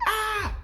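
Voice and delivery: a sharp, high knee-jerk yelp; startled cry. Retold or imitated. Reacting to a sudden emotion, a high startled yelp. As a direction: yelp as if suddenly hit by a feeling. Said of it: "you feel something and you're like".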